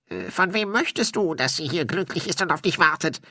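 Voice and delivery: high, hesitant, wary spirit voice; high-pitched ghost, unsure of the question; suspicious. A narrator's reading. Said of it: "fragte er argwöhnisch".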